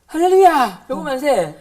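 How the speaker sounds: loud high hallelujah cheer; mock cheer, loud. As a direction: cheer like a rowdy classmate. Classmates cheering.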